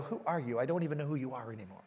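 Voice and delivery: soft, low sisterly jab, quick at the end; mock disbelief. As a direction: tease him quietly as if he's a stranger. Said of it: "she'd go".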